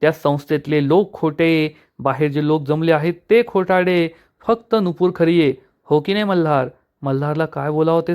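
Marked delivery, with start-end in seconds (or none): none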